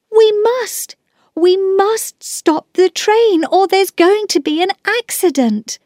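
high, pleading urgency; alarmed storybook child; insistent child. Acted.

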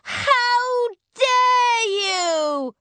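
high, loud, drawn-out outrage; furious cry. A narrator's reading. Kate to the witch who took the picnic, furious cry. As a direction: screech it slowly in indignation. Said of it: "Kate was very angry".

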